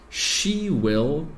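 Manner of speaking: ordinary talk, nothing marked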